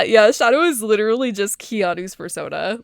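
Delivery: ordinary talk, nothing marked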